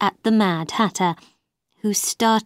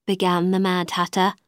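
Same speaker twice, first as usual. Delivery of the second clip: plain reading voice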